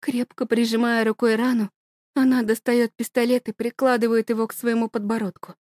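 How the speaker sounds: ordinary delivery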